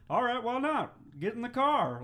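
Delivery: loud, high hammed-up dad; playing the dad, loud mock order; gruff mock dad. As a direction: bark it like an exasperated father. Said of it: "I'll be the dad".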